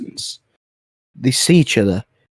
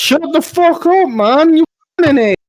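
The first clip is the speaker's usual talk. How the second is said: mock angry yell; 6 dB louder than usual. Real, not acted. Telling friend to shut up.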